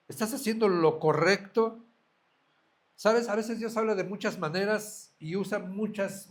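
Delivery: fervent sermon voice; preaching at full force. Acted. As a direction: push the questions home to the flock. Preacher presses the congregation. (predicación intensa y encendida)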